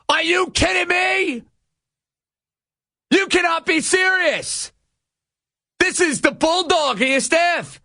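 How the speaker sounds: high-pitched, incredulous sports-radio outrage; indignant rant. Real, not acted. Host rails at star pitcher skipping postseason, indignant rant. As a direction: pitch way up in real disbelief, hammering each phrase.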